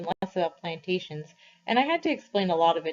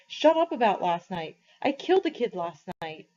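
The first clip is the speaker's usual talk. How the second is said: higher, tearful pleading in anguish; a boy sobbing out his guilt, mildly; crying; 7 semitones higher than usual. A narrator's reading. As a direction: push it out through tears. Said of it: "He was crying".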